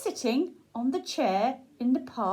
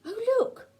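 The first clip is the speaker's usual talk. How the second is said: dog voice; 11 semitones higher than usual. Acted. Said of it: "says the dog".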